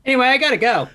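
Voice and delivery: high, loud, panicky excuse to leave; nervous hasty exit. Acted. Character realizes he's alone in a graveyard, panicky exit. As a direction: blurt it high and loud to get away.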